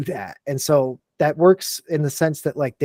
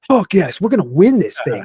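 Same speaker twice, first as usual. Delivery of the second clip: fired-up soldiers; 5 semitones higher, 5 dB louder than usual. Retold or imitated. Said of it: "they were like".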